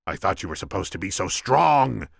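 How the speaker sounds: loud accusation, swelling at the end; shouting. A narrator's reading. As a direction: shout a scornful reproach. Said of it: "the Sicilian shouted".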